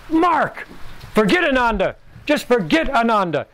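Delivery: high, sudden outburst at a pupil; retold yell. Swami suddenly rebukes Mark for his motive, retold yell. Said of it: "just yelled at me".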